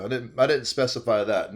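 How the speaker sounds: normal talking voice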